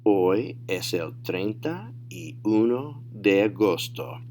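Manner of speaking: slow, word-by-word model answer; deliberate teacher demo; slow, careful model. Shown as a demonstration. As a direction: say "Hoy es el treinta y uno de agosto" slowly for learners. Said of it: "say them at natural speed".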